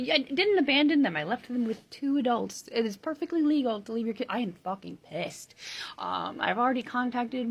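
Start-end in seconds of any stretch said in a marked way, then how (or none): 0.1-1.2 indignant. Real, not acted. Angry rant about CPS.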